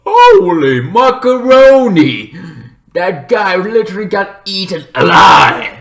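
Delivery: high, loud, slow stunned cry; shocked exclaim. Retold or imitated. Reacting to the stepson being eaten, loud high shock. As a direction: blurt out your shock at the gore. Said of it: "I'm more like".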